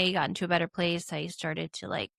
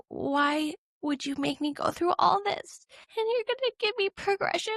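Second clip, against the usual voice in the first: higher, pleading and close to tears; tearful anguished plea; 11 semitones higher than usual. Retold or imitated. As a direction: cry out to God, hurt and desperate. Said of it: "I'm so mad at you".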